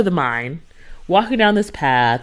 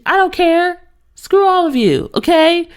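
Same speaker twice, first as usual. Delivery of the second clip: high-pitched indignant comeback; defiant; 12 semitones higher, 4 dB louder than usual. Real, not acted. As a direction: snap back at the doubters, high and emphatic. Defiant retort to imagined mockers.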